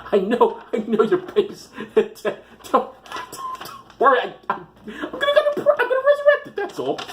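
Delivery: high, stammering voice breaking into laughs; halting, squeaky mock consolation; flustered mock pastor. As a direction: sputter a hammed-up reassurance through laughter. Acted pastor consoling the bereaved mother.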